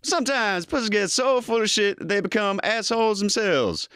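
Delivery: high, excited Eddie Murphy Donkey. Acted. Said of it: "Eddie Murphy, go".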